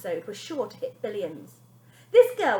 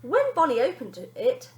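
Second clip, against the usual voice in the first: usual speaking voice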